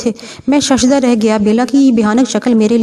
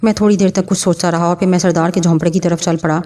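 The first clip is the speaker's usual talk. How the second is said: ordinary delivery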